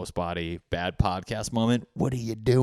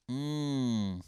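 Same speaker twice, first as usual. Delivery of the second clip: usual speaking voice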